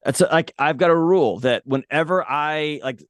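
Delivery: normal talking voice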